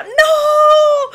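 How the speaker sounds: very high, loud cry of horror; anguished shout. Real, not acted. Commentator sees a contestant doomed, shrieks in horror. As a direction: yell it like you can't bear to watch.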